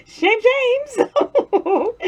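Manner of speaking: high, giggling mock scolding; laughing. Real, not acted. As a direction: giggle it out while teasing yourself. Laughing at wrecking clean kitchen.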